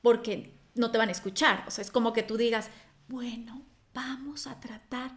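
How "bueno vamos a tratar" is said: barely audible, slow near-whisper; far too quiet. Shown as a demonstration. Speaker showing how not to address an audience, timid near-whisper. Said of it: "trata de tampoco bajarlo tanto".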